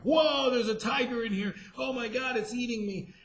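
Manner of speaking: high-pitched pretend alarm; mock panic. Pretending on camera a tiger is attacking, mock panic. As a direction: play at being terrified, pitch jumping up.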